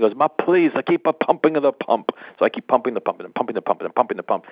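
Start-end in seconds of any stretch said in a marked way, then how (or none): 0.2-2.1 higher mock Italian accent, pleading. John pleading with Richard to keep pumping, mock Italian accent.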